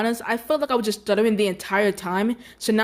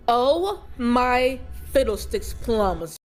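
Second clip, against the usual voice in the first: slow drawn-out amazement; amazed; much slower than usual. Real, not acted. Showing viewers the glowing room, amazed. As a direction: stretch every word in wonder.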